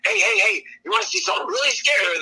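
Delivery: high, quick, eager invitation; acted-out impish question; teasing passenger. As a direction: tempt the driver with a scare. Aykroyd character teasing driver.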